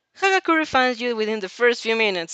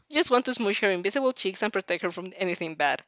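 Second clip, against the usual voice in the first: ordinary delivery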